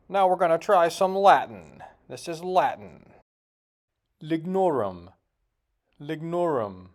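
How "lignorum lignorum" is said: "Lignorum" with a hard, sounded G; G pronounced in Lignorum. Real, not acted. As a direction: say the G in "Lignorum" as in "signal". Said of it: "the G is silent in Latin".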